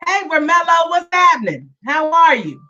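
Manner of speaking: usual speaking voice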